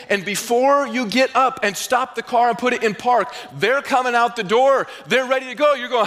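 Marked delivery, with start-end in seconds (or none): none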